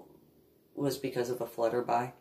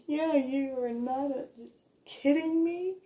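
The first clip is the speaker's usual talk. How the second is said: high, playful teasing; teasing baby talk; 11 semitones higher, 3 dB quieter than usual. Retold or imitated. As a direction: tease the big dog. Talking to grown dog.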